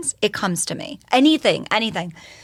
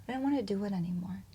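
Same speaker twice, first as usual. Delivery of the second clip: whispered confession; 10 dB quieter, faster than usual. Retold or imitated. Confessing she wanted to die.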